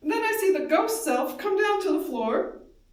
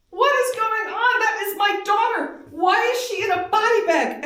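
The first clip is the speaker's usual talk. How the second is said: higher, louder anguished yell; retold scream; 8 semitones higher, 7 dB louder than usual. Mother screaming at police over her daughter's body, anguished yell. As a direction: cry out in disbelief at the daughter's body. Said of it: "And I scream".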